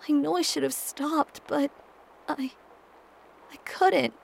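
high, cracking, about to sob; voice breaking. Acted. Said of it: "My voice breaks and a sob slips out".

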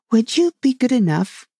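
high, polite plea for a rest; lightly voiced girl short of breath; mildly winded request. A narrator's reading. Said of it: "just to get one's breath again".

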